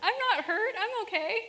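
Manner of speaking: put-on high, loud small child; little kid voice. Toddler reassuring herself after a fall, in a high child voice. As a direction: answer like a relieved toddler. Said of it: "her kid would look up and go".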